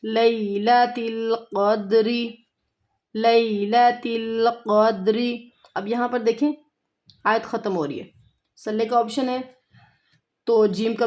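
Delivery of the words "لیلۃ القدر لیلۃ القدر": لیلۃ said as لئی, not لے; correct لیلۃ. Shown as a demonstration. Teacher models لیلۃ القدر with the لئی sound. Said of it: "لئی پڑھنا ہے".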